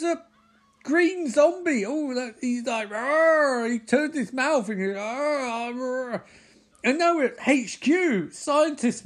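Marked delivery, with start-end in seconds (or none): 2.9-3.7 high mock zombie "arr"; playful monster roar; imitated zombie roar. 5.0-6.2 drawn-out mock zombie moaning; playful undead "arr"; imitated zombie groan. Imitates the zombie's mouth noises.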